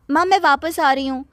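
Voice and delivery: put-on high, pouty teen daughter annoyed at her father; spoilt daughter. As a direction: whine it like a spoilt girl. Minni calls from America. (ऊँची आवाज़ में बिगड़ैल बेटी)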